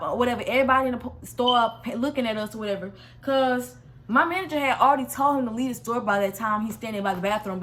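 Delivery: ordinary talk, nothing marked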